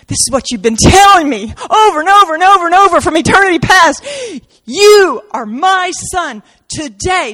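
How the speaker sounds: high, loud, fast outburst; bursting with joy; the Son exclaiming to the Father with delight; joyful shout. Acted.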